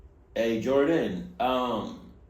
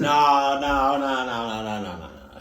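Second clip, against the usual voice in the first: fading refusal; 3 semitones lower, 4 dB louder, slower than usual. Acted.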